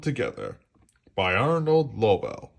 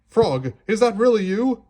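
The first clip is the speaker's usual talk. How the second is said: amazed; 10 semitones higher, 6 dB louder than usual. A narrator's reading. Toad amazed on waking.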